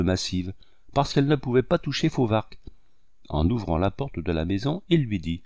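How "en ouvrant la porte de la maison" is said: ordinary delivery, nothing marked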